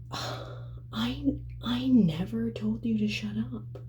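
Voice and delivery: lightly voiced boyfriend, innocent denial; mock denial.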